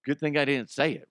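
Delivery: ordinary talk, nothing marked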